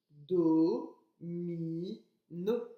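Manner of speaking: "Do, mi, no" détaché, le "Do" fort et allongé; syllabe par syllabe. Shown as a demonstration. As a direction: relire en séparant chaque syllabe. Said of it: "j'ai écrit domino". (en scandant lentement chaque syllabe)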